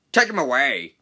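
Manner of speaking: loud, commanding bellow; bellowed order. Acted. Station master ordering the noisy engine away, bellowing. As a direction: order it over the din. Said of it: "he bellowed".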